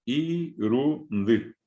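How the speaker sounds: Irundi drawn out slowly, syllable by syllable; slow teacher's demo of Irundi. Shown as a demonstration. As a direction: spell out Irundi slowly for students. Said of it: "cuando yo silabeo".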